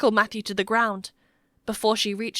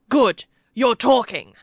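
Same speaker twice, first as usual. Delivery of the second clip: loud, menacing boom; 2 semitones higher, 7 dB louder than usual. A narrator's reading. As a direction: boom it out over the whimpering. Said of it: "His voice boomed over the child's whimpers".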